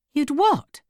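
high, incredulous question; shocked. A narrator's reading. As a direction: pitch jumps in shock. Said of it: "her face shocked".

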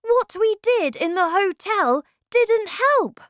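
put-on high, loud child's wail of disappointment; dismayed boy. A narrator's reading. Boy tells grandmother their work failed, dismayed high voice. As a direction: protest in a small boy's high voice.

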